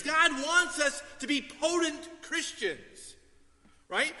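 usual speaking voice

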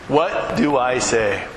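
slow, deliberate question; slow repeat. Acted. Preacher repeating a believer's worry, slow and deliberate. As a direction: draw the question out word by word.

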